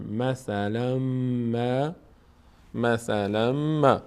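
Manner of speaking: slow "مثلاً ما" as masalam-maa; merged M. Shown as a demonstration. Teacher shows m replacing n, held M. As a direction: hold the double m in "مثلاً ما".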